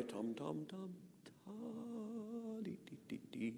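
tum tum tum ta held, then a very soft dee dee dee; delayed accent. Shown as a demonstration. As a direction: lean into ta and let dee dee dee fall away softly. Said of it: "or you might go".

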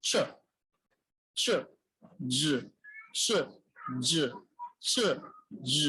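চ said unvoiced, জ with voice, alternating in isolation; paired sound demo. Shown as a demonstration. Teacher drilling the চ and জ pair for students. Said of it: "হ্যাঁ, যেমন".

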